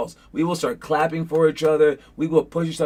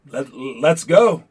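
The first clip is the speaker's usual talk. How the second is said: slow, loud gunslinger challenge; halting, drawn-out hero line, acted out; movie gunfighter; 5 dB louder, much slower than usual. Retelling a surrounded cowboy's last stand, gunfighter's challenge. Retold gunfighter facing twenty men.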